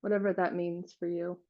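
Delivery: unhurried meditation guide, long silences between phrases; guided meditation. Real, not acted. Leading listeners to relax and feel their hearts, guided meditation voice. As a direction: guide them gently, leaving space after each phrase.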